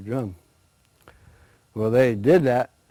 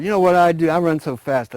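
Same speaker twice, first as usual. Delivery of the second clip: higher, louder bragging; boastful deer; 7 semitones higher, 8 dB louder than usual. Acted. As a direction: show off your speed.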